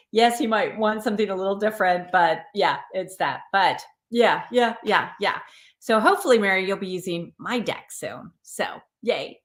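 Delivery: ordinary talk, nothing marked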